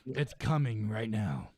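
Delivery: low, slow mock deep thinker; slow pondering voice. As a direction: drag it out like a sage. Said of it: "He's like".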